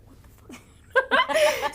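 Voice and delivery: high, loud disbelieving what the fuck, laughing; shocked laugh. Retold or imitated. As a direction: burst out in amazed disbelief. Producer hearing odd song title.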